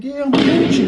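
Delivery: covering up that he's rattled; overbright fake calm. To his grandmother after the robbers leave, high and falsely cheerful. Shaken, faking fine.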